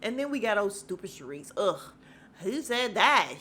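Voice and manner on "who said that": mock indignant. Mimicking Sharice butting in.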